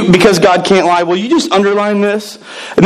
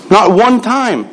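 "one" raised and pressed; rising emphasis; 4 semitones higher, much slower than usual. Shown as a demonstration. Pastor to congregation, stressing "one" more firmly. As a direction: lift "Not one time." higher than the first. Higher second take.

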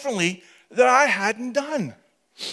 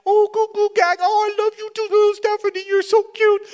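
put-on high cooing baby talk, much higher; 13 semitones higher, 3 dB louder than usual. Father cooing to his baby daughter in high baby talk. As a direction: coo it up high like to an infant.

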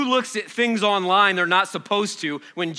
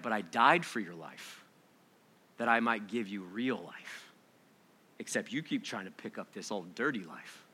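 gentle voice of Jesus; 6 semitones lower, 4 dB quieter than usual. Acted. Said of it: "And he's like".

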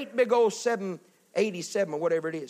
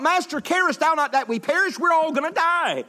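high-pitched, hammed-up alarm; panicked cry; 10 semitones higher, 6 dB louder than usual. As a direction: cry out as if all are doomed. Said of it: "there's the panic of the saints".

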